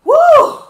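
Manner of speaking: very high loud woo; winded woo. Real, not acted. Back from fetching the oil, a loud high breathless woo. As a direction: whoop it out breathless.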